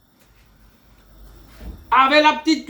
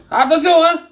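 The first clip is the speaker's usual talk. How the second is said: higher, louder call of caution; a raised, higher warning shout; 6 semitones higher, 5 dB louder than usual. Shown as a demonstration. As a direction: raise the voice to warn them. Cook repeats warning, louder.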